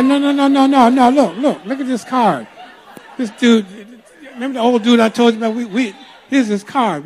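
high, loud, insistent rush of words; urgent retold protest. Husband explaining the card to his wife, urgent protest.

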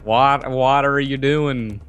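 badly faked Australian; bad Australian accent. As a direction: echo a faked Australian accent, stretching the words. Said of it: "do an Australian accent, mate".

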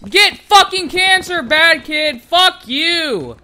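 high, loud furious yelling; explosive outburst at full shout; real rage. Real, not acted. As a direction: scream insults at the player who beat you. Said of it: "I was raging a little bit there".

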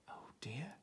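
ordinary delivery

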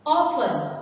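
OFten, as a model word; stress on first syllable. Shown as a demonstration. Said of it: "the stress is on the first one".

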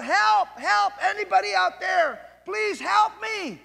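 high, loud desperate cries for help; man dangling from a branch shouting for help; crying out for help. Acted. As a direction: yell for help from the cliff edge. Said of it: "he's crying out".

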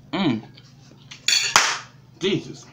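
hums through closed chewing lips; savoring food mid-bite; mouth full while chewing. Real, not acted. Seen 1: Fork enters mouth at -1.0; chewing, lips closed while murmuring.

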